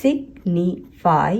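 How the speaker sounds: Signify slowly as सिग, नि, फाई; slow syllable-by-syllable signify; three separate syllables. Shown as a demonstration. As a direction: break signify into three beats. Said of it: "ये तीन syllable word है".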